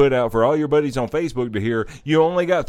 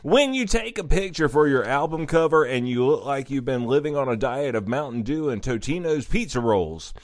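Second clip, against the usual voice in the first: ordinary talk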